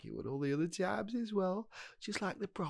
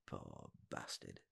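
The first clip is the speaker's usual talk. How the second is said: put-on weary old mentor. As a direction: mourn it like a ruined life. Old man's grave warning.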